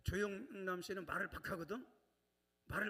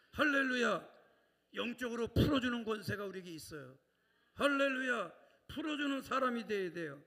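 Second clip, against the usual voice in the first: preaching at full force; 6 dB louder than usual. Acted. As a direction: proclaim it to the congregation. (힘차게 설교하는 목소리)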